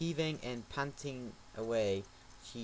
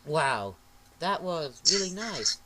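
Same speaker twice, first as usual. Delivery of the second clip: dreamy thought; 3 semitones higher, 7 dB louder, slower than usual. Acted. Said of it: "he thought to himself".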